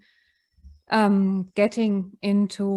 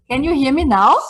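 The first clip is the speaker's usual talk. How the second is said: raised voice, louder and higher; calling out; 4 semitones higher, 8 dB louder, faster than usual. Real, not acted. Calling to a remote listener to check the sound, loudly. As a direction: call out to check the connection.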